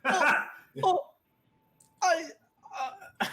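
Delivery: high, broken, halting squeaks; terrified stammering. Acted. Restrained bandit watching attackers approach, terrified high stammer. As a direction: stammer helplessly in acted terror.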